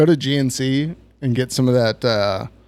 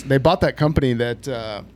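ordinary talk, nothing marked

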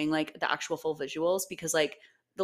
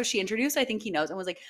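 ordinary talk

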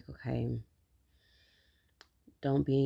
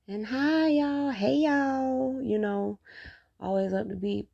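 much higher, louder bubbly greeting voice; perky vlogger greeting; 11 semitones higher, 5 dB louder than usual. Shown as a demonstration. Mocking her own upbeat intro to viewers, much higher. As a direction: do the always-upbeat vlogger hello. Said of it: "this is me. This is how I sound".

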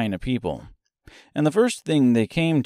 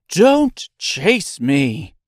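louder, slow frightened cry; frightened plea; 6 semitones higher, 6 dB louder, much slower than usual. A narrator's reading. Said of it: "such a frightened little voice".

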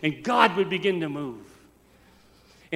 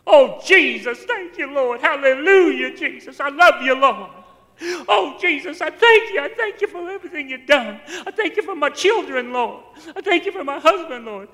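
high, loud fervent prayer, imitating her; crying out in prayer; 9 semitones higher, 9 dB louder than usual. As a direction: pour out thanks to the Lord at a high pitch. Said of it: "Just crying out to the Lord".

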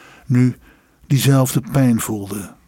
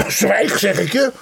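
strained, higher-pitched command; roared order; 9 semitones higher than usual. A narrator's reading. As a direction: demand silence, furious but held in volume. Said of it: "brulde Jevgraf Ivanovich". (woedend gebruld bevel)

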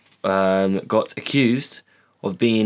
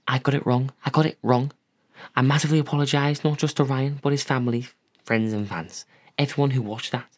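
mock apology; 4 semitones higher than usual. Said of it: "Roxanne Pallett on The Punch and claims".

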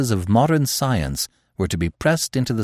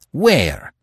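sharp, raised demand; a touch of rage, higher; sudden rage; 4 semitones higher than usual. A narrator's reading. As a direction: snap the question out in sudden anger. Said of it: "demanded Terry in sudden rage".

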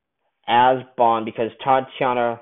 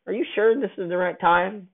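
Bond's pointed question; 8 semitones higher than usual. Retold or imitated. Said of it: "and he says".

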